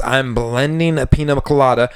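ordinary talk

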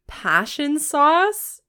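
loud, slow, high disbelief; incredulous. Real, not acted. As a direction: stretch it out in shock. Incredulous echo of the phrase.

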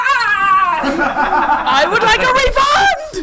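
hammed-up customer outrage; mock complaint. Game master voicing a complaint about the clone's death, loud and drawn out.

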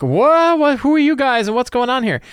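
a caught-off-guard guard squealing in surprise; guard surprised by intruders, high startled yelp. Retold or imitated.

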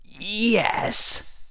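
high, soft, cold sibilant voice; Dark Lord voice. Acted. Harry inwardly playing the Dark Lord, cold and soft. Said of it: "simulating the Dark Lord".

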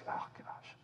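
breathy groan of dismay; a facepalm whisper; exasperated whisper. Retold or imitated. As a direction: whisper it in disbelief. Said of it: "And Isaiah's like".

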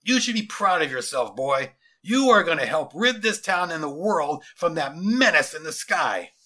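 loud, declaiming commander; booming military speech; pompous general. Acted. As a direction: proclaim it grandly to a captive. General's figure.

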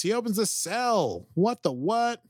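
high, loud incredulous outburst; can't believe what he just did; shocked disbelief. Real, not acted. Shocked reaction to plot twist.

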